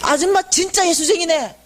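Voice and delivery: high-pitched exclamation, imitating the vendor; retold shout of praise. Tape seller praising the volunteer, high exclamation. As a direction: call it out as blunt admiration.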